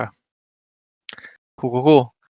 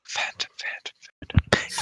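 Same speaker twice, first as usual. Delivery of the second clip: whispering; 14 dB quieter, slower than usual. Real, not acted. Whispering the creature type.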